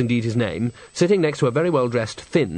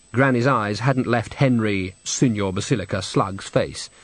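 ordinary delivery

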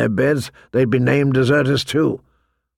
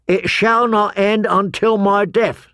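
slow, raised-pitch vow; oath recitation; 7 semitones higher than usual. A narrator's reading. Boys reminding John of his oath, reciting. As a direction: chant the vow out deliberately.

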